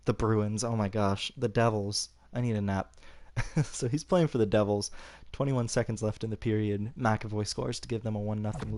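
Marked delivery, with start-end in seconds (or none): none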